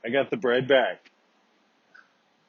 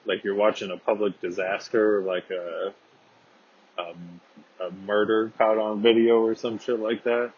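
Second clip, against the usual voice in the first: usual speaking voice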